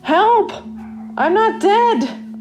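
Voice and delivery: desperate high scream for help. Acted. Trapped in a coffin, screaming to anyone outside, terrified. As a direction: shriek it out, terrified. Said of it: "I scream".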